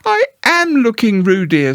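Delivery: high, stern motherly Kanga; firm as a strict mother; severe. Acted. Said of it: "rather severely".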